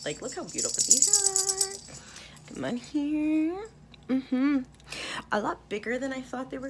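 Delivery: real delighted high-pitched gushing; gushing excitement. Real, not acted. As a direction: squeal over the pendants. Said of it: "I'm so excited".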